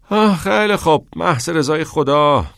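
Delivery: fed up, throw up your hands; exasperated sigh. Acted. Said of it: "ناکس آه کشید". (کلافه و با آه، بلند)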